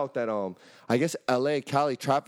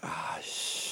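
low soft resigned groan; mock dismay; 9 semitones lower, 10 dB quieter than usual. Mechanic friend sees the car again, low resigned sigh. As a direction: sigh it out, defeated.

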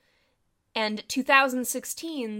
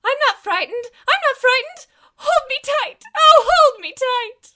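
high, loud hammed-up terror; mock frightened; 14 dB louder than usual. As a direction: squeal it like a scared horror heroine. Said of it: "as we say at the end of every episode".

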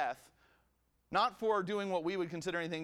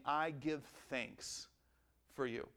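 gentle thanks; 4 semitones lower, 6 dB quieter, slower than usual. Retold or imitated. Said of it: "he starts out saying".

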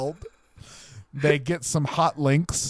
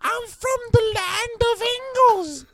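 very high, strained Gollum-like squeal; whiny, squealed mock voice; Tom Holland impression. Tom Holland complaining about the impression of him, squealed voice. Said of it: "You made me sound like Gollum".

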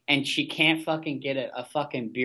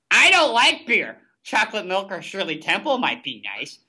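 mocking Jenna; 5 semitones higher, 3 dB louder than usual. Podcaster mocking Jenna's quote in a high ditzy voice. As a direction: mimic her dumb line in a high prissy voice. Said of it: "Jenna gave the quote of the show".

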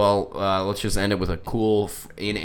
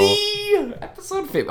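excited. Real, not acted. Joking exclamation.

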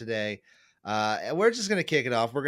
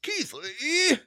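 excited fan shriek. Retold or imitated. Imitating a surprised NXT fan.

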